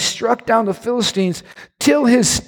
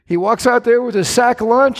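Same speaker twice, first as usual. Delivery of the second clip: usual speaking voice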